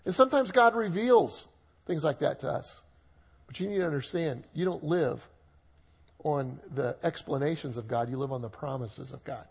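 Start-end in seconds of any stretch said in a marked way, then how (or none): none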